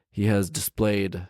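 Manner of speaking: ordinary delivery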